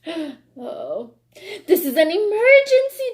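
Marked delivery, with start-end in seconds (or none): 1.7-3.1 higher, louder mock urgent fox; fox declaring. Fox tricking the birds into the truck, urgent and loud. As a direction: declare it like a sly fake cop. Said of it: "declared the fox".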